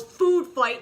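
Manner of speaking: sudden loud party yell. Retold or imitated. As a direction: shout it like a frat guy. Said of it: "John Belushi yells".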